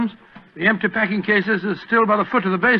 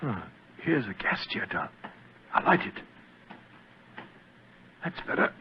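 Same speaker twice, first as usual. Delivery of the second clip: whisper; 7 semitones lower, 8 dB quieter than usual. Acted. Sneaking into dark cellar.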